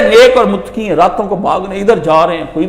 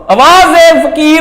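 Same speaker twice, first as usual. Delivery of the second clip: slow, loud, higher call at the gate; beggar's loud call; 6 semitones higher, 5 dB louder, slower than usual. Retold or imitated. Beggar calls out loudly at the palace gate. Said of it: "بلند آواز سے آ کے اس نے آواز دی".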